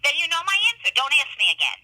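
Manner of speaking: upset. Real, not acted. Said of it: "why are you getting so upset".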